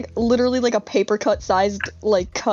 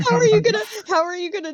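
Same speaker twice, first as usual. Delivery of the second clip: laughing; 10 semitones higher, much faster than usual. Real, not acted. Cracking up at Google Translate.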